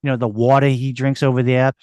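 hammed-up Boston local; thick put-on Boston accent, street-corner chatter. Shown as a demonstration. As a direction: rattle on like a guy from Boston. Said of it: "Boston, take, take one, action".

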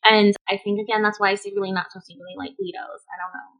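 ordinary talk, nothing marked

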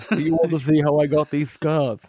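a big, theatrical villain line; Joker impression. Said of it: "He's like the fucking Joker".

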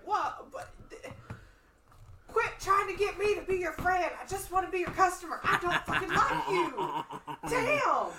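loud, high, laughing rant at the dealer; mock outraged yelling; exasperated shout. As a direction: yell it through a laugh, fed up. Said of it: "And I'm like".

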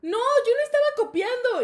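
high, very loud mock protest; comic insistent denial with laughter; indignant denial. Caught boy denying cheating to teacher, loud mock protest.

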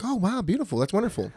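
very high mock-gushing praise; fake gushing praise. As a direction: overdo the polite wow. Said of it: "we're all like".

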